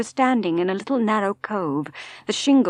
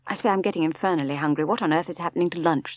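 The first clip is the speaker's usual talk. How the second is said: hurried change of subject; faster than usual. Acted.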